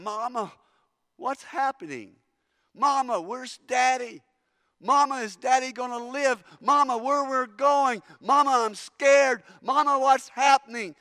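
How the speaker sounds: put-on high, loud cries of a scared child calling Mama; frightened child. Children on the train begging their mother, high frightened cries. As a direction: wail like a terrified kid on the train. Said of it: "your kids are going".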